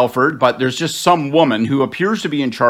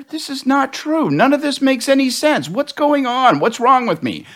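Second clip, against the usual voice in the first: like waking inside a dream you can't trust; standing by the wrecked car, baffled and distressed; bewildered; 12 semitones higher than usual. Retold or imitated. Lucid moment beside wrecked car.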